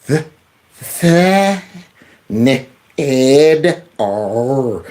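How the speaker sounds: "The, ne, ed, R" in separate syllables; laboured sounding out. Shown as a demonstration. Host attempting surname "The, ne, ed, R", laboured sounding out. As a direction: stretch "The", then piece out "ne, ed, R". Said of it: "I'm going for it again now".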